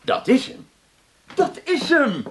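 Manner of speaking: high, thrilled exclamation; excited discovery. Acted. Director spotting the right man for the part, excited discovery. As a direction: seize on it, delighted. Director finds his actor.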